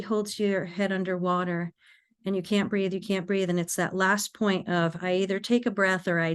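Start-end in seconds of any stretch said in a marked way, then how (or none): none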